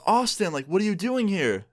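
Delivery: high, startled, slow "what are you doing here"; surprised high voice. Retold or imitated. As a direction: caught off guard, voice jumping up. Girlfriend caught cheating, surprised.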